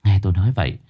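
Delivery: ordinary delivery, nothing marked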